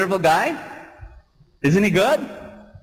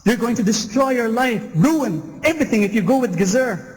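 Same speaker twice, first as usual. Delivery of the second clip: near shout; 6 semitones higher than usual. Acted. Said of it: "Joseph almost shouts".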